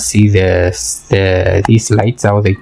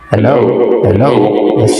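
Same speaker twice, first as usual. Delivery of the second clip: mic test with the bass effect switched on. Real, not acted. Reviewer testing the bass voice mode, boomy mic. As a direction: say hello through the bass-boost setting. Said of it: "the bass has changed".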